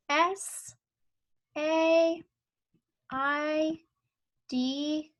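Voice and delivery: letters spaced out one by one; spelling out letters. Shown as a demonstration. Teacher spelling a sight word while tracing, slow. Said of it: "write the word said".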